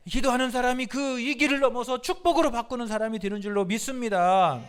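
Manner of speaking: forceful pulpit declaration, building; rousing preaching. Real, not acted. Preacher urging congregation to be people of prayer, rousing. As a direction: drive each line home to the crowd.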